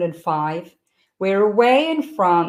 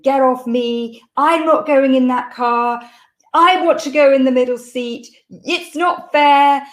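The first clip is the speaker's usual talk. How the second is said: louder, higher mock tantrum; retold child's screaming; 6 semitones higher, 6 dB louder than usual. Small boy refusing to get in the car, mock screaming. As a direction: imitate a screaming small boy, protesting loudly. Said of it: "Felix is screaming".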